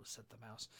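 ordinary delivery, nothing marked

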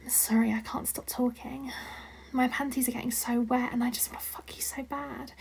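soft ASMR whisper. Acted. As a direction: breathe the words close to the mic. Sustained breathy whispered roleplay.

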